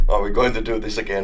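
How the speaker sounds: ordinary delivery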